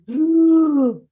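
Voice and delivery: loud, drawn-out heavy breath; Darth Vader breathing. Shown as a demonstration. Coach showing what not to record, loud Vader-like breath. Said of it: "'cause the Darth Vader impression".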